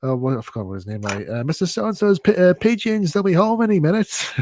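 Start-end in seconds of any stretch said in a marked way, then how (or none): 1.4-4.3 high, loud Northern English inventor voice; Wallace impression. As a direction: play the cheerful, chatty Wallace, pitched up. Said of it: "And he says".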